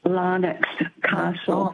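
Larnax Castle said slowly and carefully; slow, careful repeat of a place name; slow clarification. Real, not acted. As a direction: spell it out slowly for the listener. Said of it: "What's it called?".

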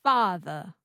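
Father with no R, transatlantic schwa ending; non-rhotic transatlantic Father; transatlantic accent. Shown as a demonstration. Learner repeats after coach, transatlantic accent. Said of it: "you drop the R".

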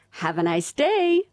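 a cheery American greeting; American accent. Retold or imitated. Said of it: "with an American accent".

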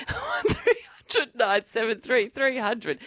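slow, drawn-out number through laughter; laughing wail, dragging out the number; mock desperate plea. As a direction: beg for callers, half laughing.

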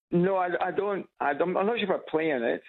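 normal talking voice

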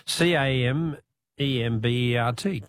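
ordinary talk, nothing marked

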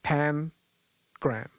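"Pangram" in two separate parts, PAN, gram; clarified pronunciation. Shown as a demonstration. Host clarifying the word for a caller, "Pangram" split carefully. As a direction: say "Pangram" slowly with PAN as in pot and pan. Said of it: "so pan as in, like, a pot and pan".

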